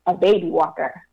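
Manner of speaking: ordinary talk, nothing marked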